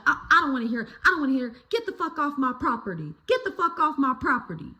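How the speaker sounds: high, fast mock yelling; imitated angry neighbor. Neighbor refuses to hear a kid explain, mock angry dismissal. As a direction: cut them off and order them out, imitating. Retold neighbor ordering kids off.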